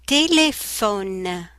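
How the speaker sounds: "téléphone" slow, each syllable clear; slow textbook pronunciation. Shown as a demonstration. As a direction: say "téléphone" slowly with an F sound. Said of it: "F, f".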